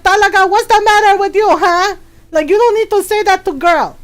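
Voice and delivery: quick, high-pitched scolding, acted as mom. Mom scolds brother after throwing chanclas, mock anger. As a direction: lay into him like an exasperated mother. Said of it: "she's like".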